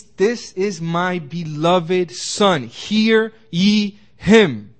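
God speaking at the Transfiguration, booming and slow. Acted. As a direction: proclaim it slowly as God from the cloud. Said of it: "and God speaks".

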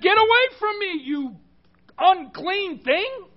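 high, indignant recoil, dragging out the last words; disgusted rejection. Acted. Preacher acts out the Pharisee rejecting the sinful woman, disgusted and high. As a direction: shoo someone off in high-pitched revulsion. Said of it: "He would have said".

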